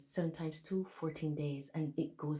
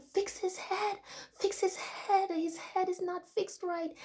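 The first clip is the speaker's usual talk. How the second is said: retold scream. Retelling a dream, screaming at staff about Jesus' head, very high desperate shout. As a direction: beg them at the top of your voice. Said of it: "I started screaming to the hospital staff".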